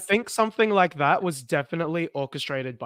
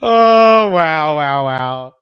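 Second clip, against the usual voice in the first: booming, drawn-out exclamation; loud amazed reaction; 12 dB louder, much slower than usual. Real, not acted. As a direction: let out a big falling wail of amazement. Big reaction to the dentist joke.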